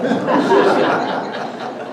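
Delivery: short airy laugh, breathy and high; amused laugh. Real, not acted. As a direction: laugh at the memory of the furniture. Mother unhappy with ugly furniture.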